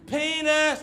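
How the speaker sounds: high-pitched schoolboy call; penis-game yell. Acted. Schoolboy trying to be funniest in class, highest yell. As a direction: top the last call, pitched right up high. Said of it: "louder and louder".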